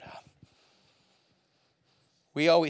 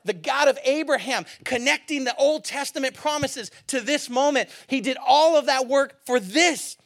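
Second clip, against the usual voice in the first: higher and louder, driving the story; impassioned preaching; 12 semitones higher, 11 dB louder than usual. Acted. Pastor retelling Peter before the crowd, impassioned preaching. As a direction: preach it like the crowd is in front of you.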